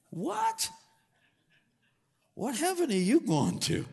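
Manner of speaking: high squeaky What then a mock-shocked question; shocked disbelief at the boast; incredulous. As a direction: act stunned by the claim. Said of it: "You're going".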